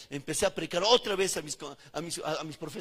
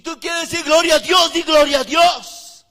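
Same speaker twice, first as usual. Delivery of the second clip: shouted, much louder and higher exhortation; fiery preaching; 10 semitones higher, 18 dB louder, slower than usual. Acted. Preacher urging the congregation to glorify God, at full force.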